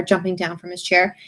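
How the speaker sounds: plain reading voice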